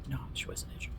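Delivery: hushed, reassuring whisper; mother's whisper. Retold or imitated. Mother whispering reassurance to her worried child.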